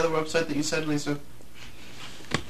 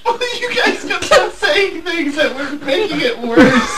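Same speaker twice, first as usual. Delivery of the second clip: high, slow, laugh-crying; crying with laughter; 4 dB louder, slower than usual. Real, not acted. Still recovering from laughing fit.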